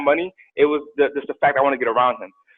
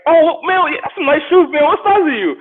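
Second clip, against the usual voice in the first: sly high voice of a robber eyeing shoes; fake-friendly robber asking shoe size; mock robber; 13 semitones higher, 4 dB louder than usual. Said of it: "Like, like".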